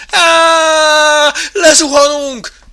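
long, high scream into slow cry; terrified shriek. Acted. Mouse plunges down the coaster, shrieking. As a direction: shriek as if falling. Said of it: "visított Benc úr".

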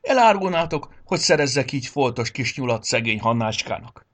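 loud, exasperated telling-off; outraged reproach; indignant scolding. A narrator's reading. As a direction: scold the whole unruly gang. Santa keeps scolding the rabbits.

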